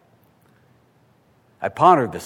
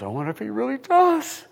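a higher, anxious inner voice; doubting father; 12 semitones higher than usual. Retold or imitated. Father doubts on the walk home, anxious inner voice. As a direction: voice the father's nagging worry.

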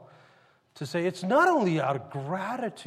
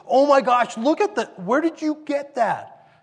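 loud, high-pitched astonishment; amazed outburst; 7 semitones higher, 8 dB louder than usual. Acted. Governor spotting a worker's painting, amazed. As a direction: act out a governor bowled over by a discovery.

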